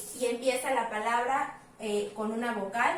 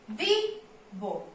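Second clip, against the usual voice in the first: Vivo with VI stressed. Shown as a demonstration. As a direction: lean on VI in vivo. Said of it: "Acá se escucha fuerte". (vivo con fuerza en VI)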